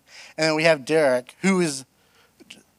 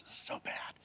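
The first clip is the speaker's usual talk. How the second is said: whisper; 23 dB quieter than usual. Real, not acted. Embarrassed aside to camera.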